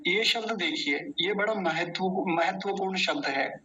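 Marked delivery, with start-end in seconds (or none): none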